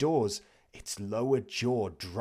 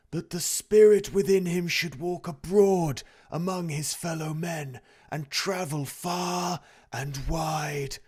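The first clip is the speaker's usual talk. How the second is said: wailing ghost; 8 semitones higher, 7 dB louder than usual. A narrator's reading. The ghost lamenting its fate to Scrooge, high and wailing. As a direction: moan it like a doomed soul.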